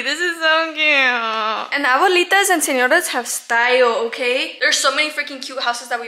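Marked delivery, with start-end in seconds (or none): 0.0-1.7 whiny plea. Real, not acted.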